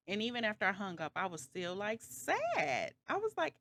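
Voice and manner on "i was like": ordinary talk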